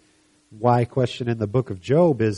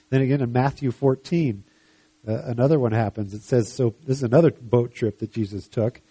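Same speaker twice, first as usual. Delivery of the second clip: ordinary talk, nothing marked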